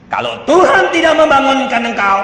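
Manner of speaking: high, loud preacherly pronouncement; emphatic declaration. Acted. Father answers the complaining child, loud emphatic declaration. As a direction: declare it with full conviction.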